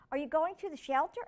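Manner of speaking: put-on high, fast girl's question; Amy asking the brothers, high and quick; eager Amy. A narrator's reading. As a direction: ask it quick like an eager girl. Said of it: "Amy asked".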